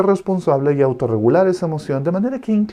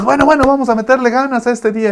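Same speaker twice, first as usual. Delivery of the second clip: high, loud, fast pep; cheerful parent; 10 semitones higher, 7 dB louder, faster than usual. Retold or imitated. Happy parent rallying the child in the morning, high and fast. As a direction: bounce in full of energy. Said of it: "Tú estás feliz y dices".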